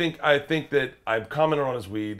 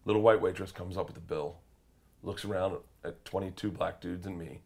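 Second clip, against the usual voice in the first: normal talking voice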